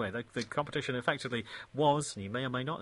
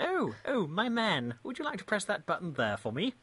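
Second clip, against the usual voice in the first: a light mock posh British voice, higher; frightfully British; 6 semitones higher than usual. Host jokes to the guest in a light mock posh British voice. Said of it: "become frightfully, frightfully British and say".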